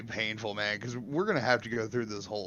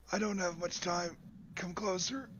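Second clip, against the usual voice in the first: soft, higher deathbed voice; dying man; 6 semitones higher, 9 dB quieter than usual. Acted. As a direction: play feebly dying, softly. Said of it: "I'm gonna be sitting on my deathbed".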